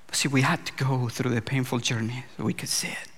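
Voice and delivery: whispered, close to the mic. Real, not acted. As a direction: drop to a hushed confession. Quiet aside after emotional story.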